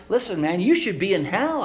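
ordinary talk, nothing marked

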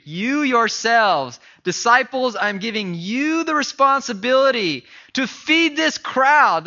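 slow, loud insistence on you yourselves; emphatic. Acted. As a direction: press the words home with force. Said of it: "It's emphatic in the Greek".

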